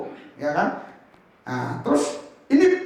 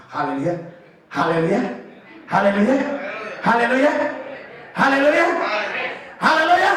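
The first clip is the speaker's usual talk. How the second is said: building hallelujahs. Real, not acted. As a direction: build the congregation up. Preacher leading crowd.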